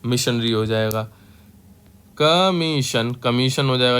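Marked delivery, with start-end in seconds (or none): none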